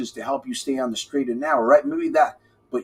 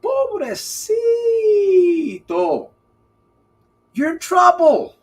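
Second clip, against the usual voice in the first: mock pity; 6 dB louder than usual. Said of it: "That's why I said".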